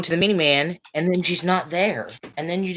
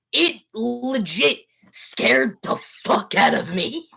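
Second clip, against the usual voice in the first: normal talking voice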